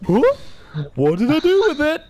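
high, loud baffled character voice; clueless game character. Acted. Game avatar puzzled at lost ammo, hammed-up confusion. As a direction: play it bewildered and hammed-up. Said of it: "My guy is just, like, gone".

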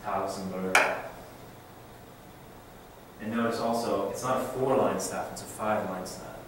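normal talking voice

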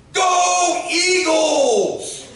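drawn-out high shout of "Go Eagles!"; excited boy. Retold or imitated. Boy cheering for his team to the visitor, long high shout. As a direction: cheer it like a thrilled kid, stretched and loud. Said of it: "he runs over to me and he goes".

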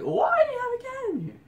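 high-pitched outraged screech; imitating a scandalized, high yell; shocked shriek. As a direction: shriek it loudly in mock horror. Acted shocked reaction to the cat.

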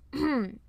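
pointed little throat clear; brief formal ahem. Acted. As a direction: clear the throat before speaking. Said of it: "Her Highness cleared her throat".

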